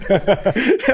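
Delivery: high rising laugh; a gleeful, rising laugh. Real, not acted. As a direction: keep laughing. Laughing at own revenge.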